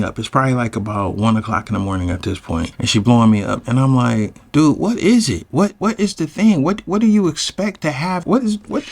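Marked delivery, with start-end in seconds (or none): 4.5-8.8 high, stammering exasperation; exasperated questioning. Retold or imitated. Man answering her late-night calls, exasperated questioning. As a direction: pitch it up, baffled and fed up.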